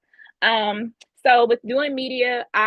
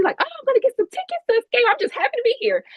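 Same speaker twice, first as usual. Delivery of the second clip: much higher, bubbly and thrilled; giddy excitement; faster than usual. Retold or imitated. Fan retelling getting concert tickets, high and giddy. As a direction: squeal about the tickets.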